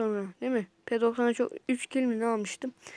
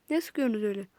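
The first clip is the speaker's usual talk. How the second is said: normal talking voice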